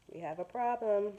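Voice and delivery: plain reading voice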